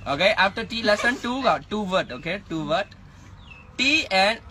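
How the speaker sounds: usual speaking voice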